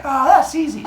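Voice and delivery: put-on high, loud breezy girl voice; mock-confident squeal; Mincinin. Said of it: "And she's like".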